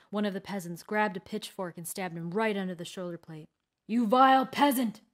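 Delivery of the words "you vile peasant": loud, raised-pitch furious shout; wounded warrior's angry outburst; enraged insult. A narrator's reading. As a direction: spit the insult out loudly. Stabbed warrior curses his attacker.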